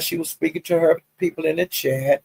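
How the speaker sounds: ordinary talk, nothing marked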